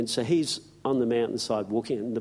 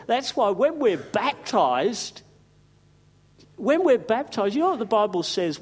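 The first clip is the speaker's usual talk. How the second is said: fervent preaching; 9 semitones higher than usual. Acted. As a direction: lift the church with joy. Preaching at full force.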